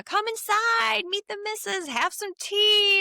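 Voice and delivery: high, drawn-out little worm voice; friendly worm. Retold or imitated. Worm invites the heroine in for tea, high hospitable voice. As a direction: invite them in, high and slow. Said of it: "And he's like".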